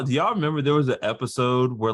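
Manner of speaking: ordinary delivery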